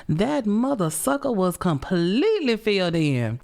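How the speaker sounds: ordinary talk, nothing marked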